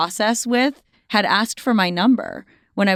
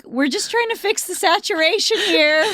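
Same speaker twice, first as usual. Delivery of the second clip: much higher, lightly put-on clerk voice; mock DMV clerk; 10 semitones higher than usual. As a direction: play the fussy photographer. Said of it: "them being like".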